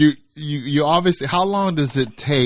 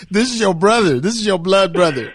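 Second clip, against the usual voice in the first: laughing; 7 semitones higher, 4 dB louder than usual. Real, not acted. Host laughing in disbelief.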